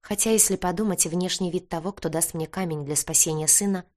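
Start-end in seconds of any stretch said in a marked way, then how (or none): none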